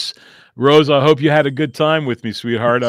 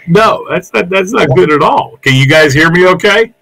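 louder, higher voice over a bad line; too loud; 5 semitones higher, 10 dB louder than usual. Real, not acted. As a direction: push the voice to get through the line. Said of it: "a little bit too loud now".

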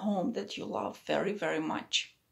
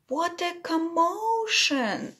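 excited; 8 semitones higher, slower than usual. A narrator's reading. Narrator exclaims at scene.

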